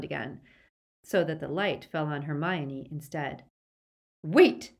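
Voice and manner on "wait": sharp, high, loud interruption; sudden sharp command; sharp Narcissa. A narrator's reading. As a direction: cut in sharply. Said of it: "said Narcissa sharply".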